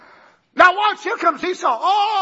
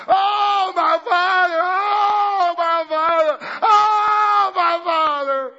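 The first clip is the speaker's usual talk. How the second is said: wailing cry; 4 semitones higher, slower than usual. Acted. Esau crying out to his father, wailing. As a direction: cry it out like a son howling.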